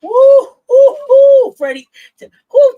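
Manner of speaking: loud, high laughing woo; real hype shouts; excited whooping. Real, not acted. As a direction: whoop loudly at the joke.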